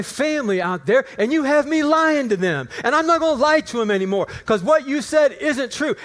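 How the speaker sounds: loud, high-pitched acted tirade at God; retold angry rant. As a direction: build into a heated accusation. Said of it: "the angrier I got".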